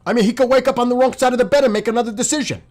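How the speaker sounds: real anger. Real, not acted. Commentator rages at an inconsistent president, fast furious shouting. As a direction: let it rip at the president, exasperated. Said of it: "I am pissed".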